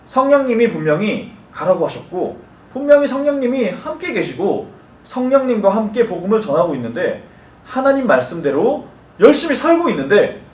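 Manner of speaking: ordinary talk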